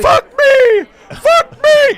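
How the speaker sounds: very high, loud scream. Acted. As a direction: scream it in panic. Screaming in improv chaos.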